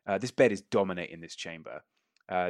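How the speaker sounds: usual speaking voice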